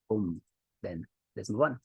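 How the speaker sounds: ordinary delivery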